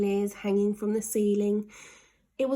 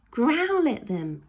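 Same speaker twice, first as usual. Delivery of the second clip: put-on slow, louder boy's voice; urging boy; 5 semitones higher, 5 dB louder, slower than usual. Ned telling Slime to growl, slow and louder. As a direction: urge him on.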